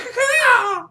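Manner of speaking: very high-pitched stuttering cackle; high mock squawk. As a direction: let out a silly shrieking cackle. Acts out shooting the downed enemy.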